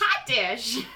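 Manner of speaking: laughing Minnesota accent; hot dish with a Minnesota lilt; Midwestern accent. Shown as a demonstration. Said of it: "I don't even know you're talking English".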